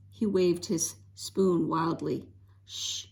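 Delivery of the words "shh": soft sharp hiss for silence; hissed shush. A narrator's reading. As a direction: hush the little brother fast. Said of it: "hissed Nan".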